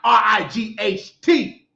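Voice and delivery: R I G H T spelled slowly letter by letter. Shown as a demonstration. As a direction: spell R I G H T out deliberately. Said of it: "It's being right".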